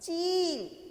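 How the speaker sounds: चील with a long held ee; drawn-out long vowel. Shown as a demonstration. Teacher contrasting with चिल, long vowel drawn out. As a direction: stretch the ee of चील slowly. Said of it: "बड़ी की मात्रा".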